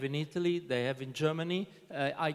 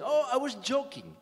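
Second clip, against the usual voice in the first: mock innocent excuse; 10 semitones higher than usual. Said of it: "he said".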